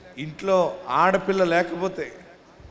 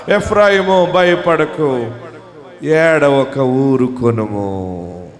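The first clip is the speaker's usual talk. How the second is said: tender comfort; 3 semitones lower, 3 dB louder, much slower than usual. Acted. Preacher voicing God's comfort.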